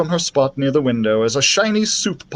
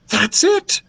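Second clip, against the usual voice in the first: high-pitched burst of excitement; excited princess exclamation; excited shout. A narrator's reading. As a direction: call it out as a sudden brainwave. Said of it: "she shouted excitedly".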